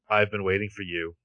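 cold, level machine-like voice; lightly robotic Terminator voice. Retold or imitated. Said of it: "this, like, slightly more robotic voice says".

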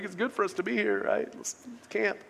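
quick, hushed imitation of nervous chatter; mock scared babbling. Preacher imitating frightened disciple just talking, mock nervous babble. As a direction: babble softly, trailing away, as if scared. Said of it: "He's so scared to death".

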